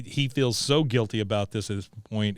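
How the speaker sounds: ordinary talk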